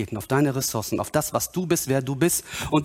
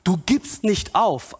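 normal talking voice

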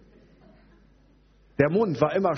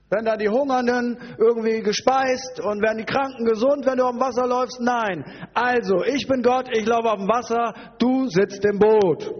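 high, raised-voice telling-off; indignant rant; 8 semitones higher, 4 dB louder than usual. Acted. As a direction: put him in his place with mock outrage. Acted rant at Petrus. (empört und lautstark zurechtweisen)

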